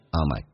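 "on my" said low and quick on a falling tone; "on my" 轻读降调; falling unstressed. Shown as a demonstration. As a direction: drop "on my" down, unstressed and light. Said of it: "用降调读变成".

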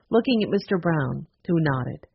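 ordinary delivery

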